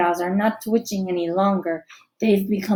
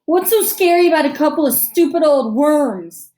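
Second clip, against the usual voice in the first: higher, louder sneer; 6 semitones higher, 6 dB louder than usual. A narrator's reading. Bully sneers at a frightened girl, higher and louder. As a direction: sneer it with scorn. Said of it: "sneers Dylan".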